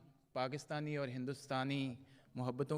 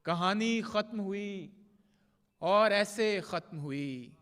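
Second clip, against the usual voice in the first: measured, projected mushaira verse with drawn-out line endings; poetry recitation; 6 semitones higher, 9 dB louder, slower than usual. Acted. Poet reciting love couplets to a mushaira audience, declaimed Urdu verse. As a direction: recite the ghazal line by line, pausing between phrases.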